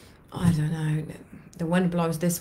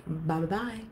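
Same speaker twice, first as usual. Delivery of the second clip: soft farewell; 5 dB quieter than usual. Shown as a demonstration. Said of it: "I want you to say".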